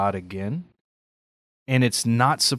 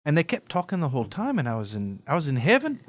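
storytelling in an Irish lilt; Irish brogue with pitch swinging up at phrase ends; Irish accent; 4 semitones higher than usual. Shown as a demonstration. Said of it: "Can you go right into it".

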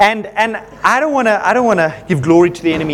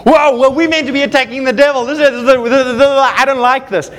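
indignant; 5 semitones higher than usual. Retold or imitated. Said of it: "I got angry".

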